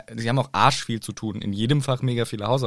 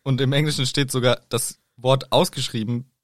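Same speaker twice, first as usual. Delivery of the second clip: ordinary talk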